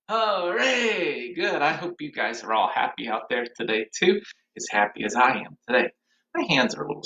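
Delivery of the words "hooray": mock cheer. Shown as a demonstration. Cheer at song's end.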